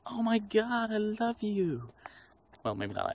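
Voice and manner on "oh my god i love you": high, slow gushing adoration; mock adoring fans. Imitating everyone reacting to his joke, high gushing. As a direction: swoon over the joke like a fan. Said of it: "everyone's like".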